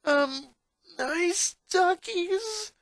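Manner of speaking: put-on high, drawn-out nervous boy voice; high, slow "nice doggies" to killer dogs; nervous placating. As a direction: coax the dogs while scared stiff. Boy faces three snarling bulldogs.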